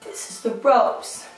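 ordinary delivery

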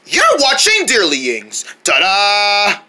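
normal talking voice

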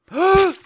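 loud, high gasp; sudden voiced intake of breath; startled gasp. Real, not acted. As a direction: gasp sharply in shock. Startled by the throw.

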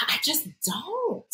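sighing, high, long-drawn "don't"; exasperated. Real, not acted. Still trying to explain her dislike, exasperated. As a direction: sigh first, then drag it out high.

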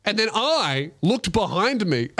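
usual speaking voice

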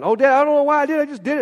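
imitating a kid's hurried excuse; son's excuse. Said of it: "especially my son".

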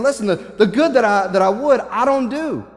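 frustrated crying out, preacher's version; Paul. Retold or imitated. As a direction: plead it out, leaning on each contrast. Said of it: "I can see Paul's frustration as he's crying out".

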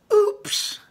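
very high, sly mocking oops; evil goblin. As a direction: say it with a wicked smile. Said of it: "with an evil smile".

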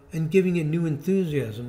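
normal talking voice

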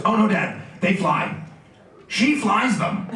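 mildly indignant imitation of a child; mad kid. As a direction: insist like a cross little boy. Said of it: "now he's mad".

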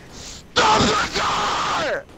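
stretched-out yell, very slow; slow drawn-out yell. Real, not acted. Begging his friend to stop the car, drawn-out yelling. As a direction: drag every word out in a long yell.

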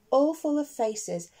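plain reading voice

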